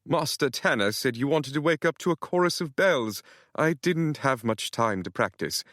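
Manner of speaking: put-on light British accent, fussy. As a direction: explain it in a fussy British manner. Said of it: "the man said in a British accent".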